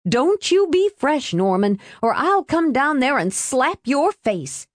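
high, scolding aunt's voice; high-pitched indignant warning; angry threat. A narrator's reading. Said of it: "she hissed".

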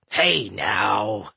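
low, bashful dwarf voice; dwarf mumbling. A narrator's reading. Dwarf just kissed on the cheek, low mumble. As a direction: mumble it low, deflecting the praise. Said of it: "the dwarf mumbled".

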